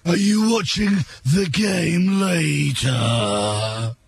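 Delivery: slow, drawn-out alien voice; bad Doctor Who character. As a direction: play it like a campy TV villain. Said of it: "some bad Doctor Who character".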